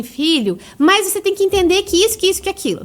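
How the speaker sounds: a lightly voiced lecturing parent. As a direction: imitate a parent explaining rationally. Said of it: "em vez de você falar assim".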